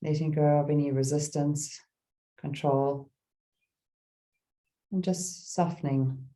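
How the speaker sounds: calm meditation guide with long pauses; gentle, spacious mindfulness voice; guided meditation. Real, not acted. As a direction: ease listeners into stillness, phrase by phrase.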